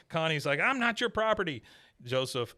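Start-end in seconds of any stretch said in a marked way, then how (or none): 0.6-1.6 higher hammed-up retort; mock indignant girl. Retelling Connie snapping at Bobby, mock indignant. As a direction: toss it back pitched up, indignant.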